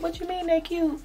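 high-pitched disbelief; incredulous. Retold or imitated. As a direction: squeak it out in shock. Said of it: "And I was like".

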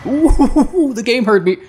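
high whoop breaking into laughter; whoop, then laugh into the words; delighted surprise. Real, not acted. Delighted that game answered complaint.

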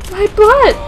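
high, loud cry; high exclaimed complaint; mock distress. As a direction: yelp it loudly. Cry at game injury.

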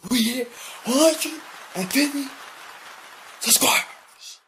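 high, full-blast celebratory scream; screaming announcement. Acted. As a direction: scream the subscriber milestone out loud. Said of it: "why are you screaming?".

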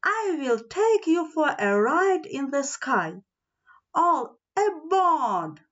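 lightly voiced train announcing a ride; train calling out. A narrator's reading. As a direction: call passengers aboard, stretching the last words. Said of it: "the train shouted".

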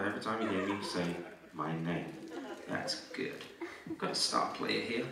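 low, very soft voice; hushed. Real, not acted. Hypnotist sealing the suggestion for a volunteer, hushed. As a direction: drop right down to near a whisper. Hypnotist soothing, then aside.

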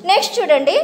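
ordinary talk

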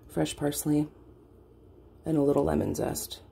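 normal talking voice

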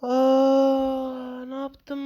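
high, stunned cry of dismay; shocked gasp. Real, not acted. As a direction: suddenly realize the disaster. Realizes he lost everything. (şok olmuş, tiz bir çığlıkla)

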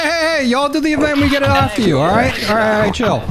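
high crying pleas to hold fire; panicking gamer. Real, not acted. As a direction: beg the other team not to shoot. Pleading with enemy team.

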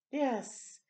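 normal talking voice

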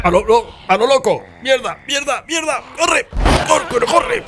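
high frantic shouting; panicked yelling. Real, not acted. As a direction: yell it while running for your life. Fleeing in panic.

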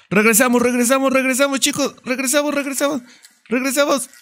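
repeated in a rising high singsong. Real, not acted. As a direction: chant it up high, playful and urgent. Urging the team to go back.

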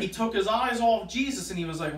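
normal talking voice

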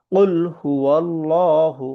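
very slow, drawn-out tajweed chant; Quran recitation. Acted. Teacher reciting the verse for the class, slow chant. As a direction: recite it slowly like a qari.

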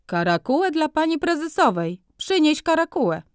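high hawker's cry toward the back room; furrier calling out. A narrator's reading. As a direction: shout for the furs, eager salesman. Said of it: "zawołał".

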